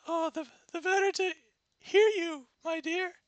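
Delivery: high, quick granny voice; wolf grandma. Acted. Wolf grandma fumbling an excuse to Red, high granny voice. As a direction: stumble through it like a caught-out granny.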